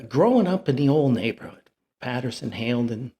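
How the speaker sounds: ordinary talk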